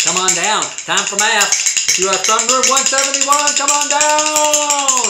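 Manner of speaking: loud, high game-show call, stretching the last down; booming come-on-down announcer; game show host. Acted. Calls rooms like game show host.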